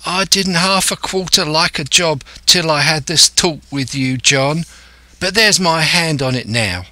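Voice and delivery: put-on high-pitched sailor boy; eager young lad. Lad replying to Silver, as an eager boy.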